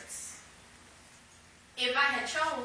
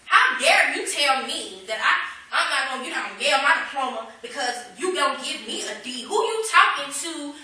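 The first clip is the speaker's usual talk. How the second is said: loud, indignant rant, slowing on the questions; huffing about the professor's nerve; retold outrage; 4 semitones higher, 11 dB louder than usual. To the congregation, replaying her fury at the professor, loud and indignant. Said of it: "And I'm mad".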